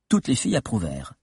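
ordinary delivery

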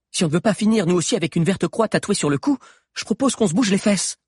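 high, brisk prodding; urgent rousing. A narrator's reading. Sabi rousing the stunned group to get moving, urgent. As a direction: shake the others into moving. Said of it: "les secoua Sabi".

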